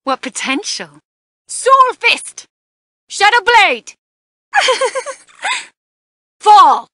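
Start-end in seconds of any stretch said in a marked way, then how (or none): 1.5-6.9 high shouted move names; fierce high battle cries; attack shouts. Acted. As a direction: call each attack out loud.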